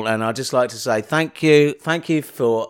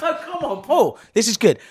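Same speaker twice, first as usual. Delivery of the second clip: excited; 9 semitones higher than usual. Real, not acted. Insisting the bit is good.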